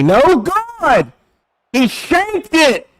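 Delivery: high, startled exclamation; shocked play-by-play. Real, not acted. Streamer seeing the field goal missed, shocked high cry. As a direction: break out in surprise at the miss.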